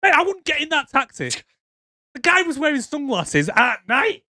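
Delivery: squeaky incredulity; incredulous rant. Real, not acted. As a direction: protest in high-pitched comic disbelief. Incredulous rant about the taxi.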